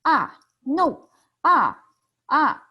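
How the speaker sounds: "Ah" and "no" high, loud, falling sharply; mock-angry protest dropping from high; fourth tone, angry. Shown as a demonstration. Patient charged five hundred dollars, loud falling protest. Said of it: "he will charge you five hundred dollars".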